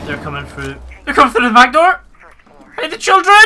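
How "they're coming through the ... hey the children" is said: much higher and louder panicked yell; yelling a warning to his squad; alarmed shout. Real, not acted. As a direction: shout the warning to your team. Enemies breaching, alarm.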